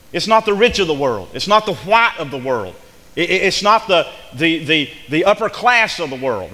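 loud, high, hammering each "not"; full-force preaching. Acted.

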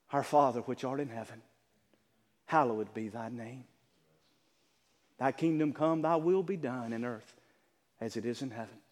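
soft, low recited prayer; tender prayer voice; reverent prayer. Acted. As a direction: pray it gently. Quoting the Lord's prayer.

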